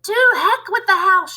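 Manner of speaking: high, loud defiant crone shout. Acted. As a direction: yell it out defiantly. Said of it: "she shouted".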